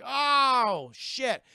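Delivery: loud, high, stretched "oh"; drawn-out exclamation of dismay; mock shock. Playing pirates finding they are human again, mock shock.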